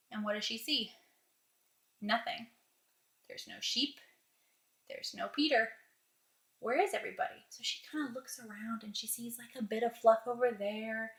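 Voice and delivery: very soft, sparse narration with long pauses; hushed suspense. A narrator's reading. Mom finds no sheep and no Peter, hushed. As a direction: draw them in almost under your breath.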